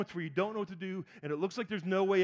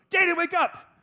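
much higher and louder wake-up call; 10 semitones higher, 9 dB louder than usual. Retold or imitated. Brother waking sleeping Jaden on the couch, loud call. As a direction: call him awake loudly.

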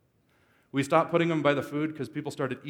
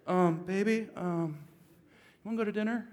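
higher, lightly sugary husband asking his wife out; mock sweet husband; 6 semitones higher than usual. Pastor mimics a husband gently asking his wife to dinner, mock sweet. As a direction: coax her like a shy husband.

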